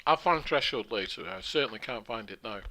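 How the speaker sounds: talking while chewing a mint; crunching a mint between words; mouth full. Real, not acted. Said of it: "Sorry for the crunching".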